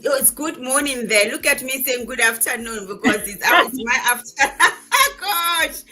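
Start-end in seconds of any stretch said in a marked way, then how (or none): 3.4-5.8 breaking up into a high, loud laugh; embarrassed slip dissolving into real laughter; laughing at own slip. Real, not acted. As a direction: laugh through the words, pitch jumping up. Laughs at her own greeting mistake.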